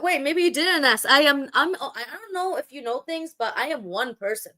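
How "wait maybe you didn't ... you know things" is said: ordinary delivery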